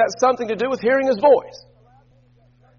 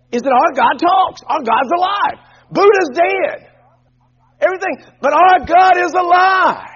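preaching shout; 7 semitones higher, 4 dB louder than usual. Real, not acted. Preacher builds to shouted climax.

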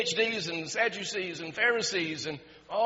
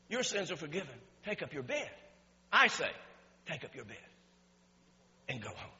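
soft, low voice of Jesus; quiet authority, with pauses between phrases; hushed command; 4 semitones lower, 7 dB quieter, faster than usual. Retold or imitated. Voicing Jesus healing the paralytic.

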